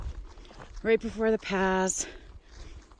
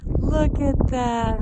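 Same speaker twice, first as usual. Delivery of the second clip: ordinary talk, nothing marked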